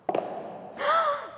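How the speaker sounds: high gasp of happy surprise; delighted gasp. Pretend. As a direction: gasp in playful amazement. Reacts to a lucky draw.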